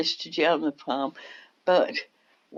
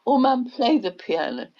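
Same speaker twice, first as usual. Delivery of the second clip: retold child begging; 7 semitones higher than usual.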